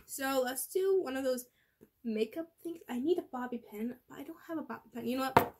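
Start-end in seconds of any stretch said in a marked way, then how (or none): none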